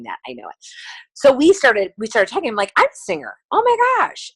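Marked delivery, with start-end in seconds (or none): 2.8-4.3 high-pitched gushing, re-enacted; mock-excited, pitch shooting way up; excited retold gushing. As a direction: squeal it lightly, as if retelling an excited chat. Retold gushing exchange with waitress.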